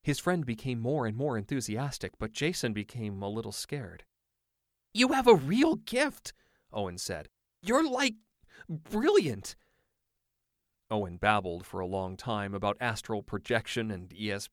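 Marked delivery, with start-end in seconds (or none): 4.9-9.6 much higher, louder, slow and wide-eyed; boy in awe of his friend's gift; gushing awe. Acted. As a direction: heap praise on him breathlessly. Owen gushing about the gift.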